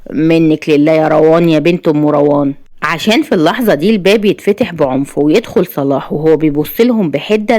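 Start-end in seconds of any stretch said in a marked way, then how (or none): none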